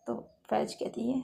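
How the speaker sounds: ordinary delivery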